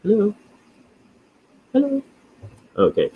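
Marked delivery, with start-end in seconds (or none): 0.0-2.0 tentative hello, climbing higher each time; high sing-song calling. Real, not acted. Host calling to the creature that won't wake, sing-song. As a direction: call out high to rouse a sleeper.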